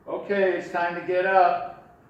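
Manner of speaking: cheerful wake-up call to the puppet. Shown as a demonstration. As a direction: call brightly into the trunk. Ventriloquist waking puppet in trunk.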